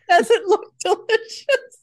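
high, slow squeal through laughter; laughing mock echo. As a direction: echo her words while cracking up. Mocking daughter's words, laughing.

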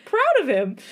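high, proud and welling up; tearful laugh in the voice; choked up with pride; getting emotional. Real, not acted. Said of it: "I'm getting emotional again".

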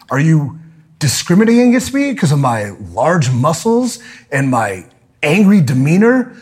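ordinary talk, nothing marked

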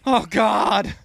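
high, loud cry of a vet mid-flashback; hammed-up panicked veteran; flashback-stricken vet. Old soldier reliving basic training, mock panic. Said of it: "a World War Two vet has flashbacks".

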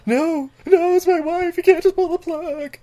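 high, hammed-up anguished protest; desperate pleading. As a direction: beg them not to pull the plug. Acted husband pleading.

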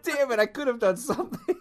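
high, loud mock frustration through laughter; laughing regret. Telling friend about the lightsaber he left behind, laughing regret. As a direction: curse your missed chance, half laughing.

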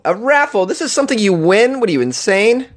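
high-pitched comic outrage; hammed-up indignant questioning; mock incredulity. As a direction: balk at it in exaggerated disbelief. Mock outrage at raffle prize.

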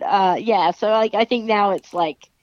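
ordinary talk, nothing marked

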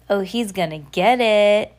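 drawn-out "get it"; slow, taunting sibling warning; gloating warning. Shown as a demonstration. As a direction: sing out that someone is in trouble, slowly. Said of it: "That's what it means".

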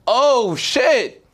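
suddenly clocking the whole crew is in on it; alarmed realization. Retold or imitated. To the card hustlers, catching on and rising in alarm. Realizing the scam, getting mad.

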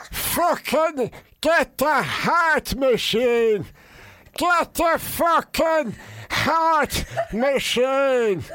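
high, drawn-out strained cries for help; winded pleading. Retold or imitated. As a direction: wail it slowly as if the wind's knocked out of you. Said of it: "'Cause he winded me".

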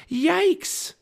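loud, very high exclamation; high, alarmed cry; startled yelp. A narrator's reading. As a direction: yelp at a sudden fright. Annie startled by row of knights.

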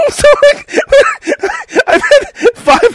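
high squealing laughter; laughing. Real, not acted. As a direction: crack up mid-sentence. Laughing hard.

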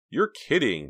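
high, loud surprised exclaim. A narrator's reading. Jade hearing about the midnight call, surprised exclaim. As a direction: burst out in disbelief. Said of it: "Jade exclaimed".

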